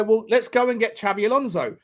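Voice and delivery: ordinary talk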